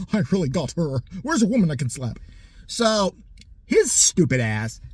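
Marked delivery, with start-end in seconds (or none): 0.0-2.2 lightly voiced smug macho man; a hint of a gloating tough guy; mock Tate. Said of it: "says something about her, like".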